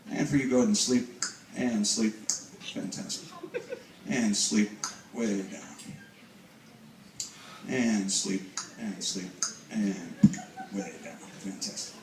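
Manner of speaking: low, soft, lulling hypnotist voice; hypnotic induction. Real, not acted. Stage hypnotist sending a volunteer way down, hypnotic induction. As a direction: ease them down into sleep, voice dropping low and soft. Hypnotist puts subject to sleep.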